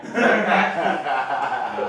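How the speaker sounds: sheepish low laughing echo. Shown as a demonstration. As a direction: drop deep and slow on I'm aware of that.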